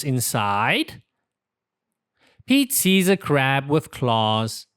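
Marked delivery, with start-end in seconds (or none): none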